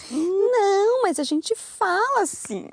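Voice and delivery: swooping high, drawn-out Brazilian intonation; very high pitch. Shown as a demonstration. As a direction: send the voice way up and draw it out. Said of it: "how high your pitch is".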